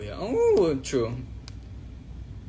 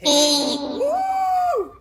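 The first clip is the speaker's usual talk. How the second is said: high shouted greeting through echo mic; digital echo; 4 dB louder, much slower than usual. Shown as a demonstration.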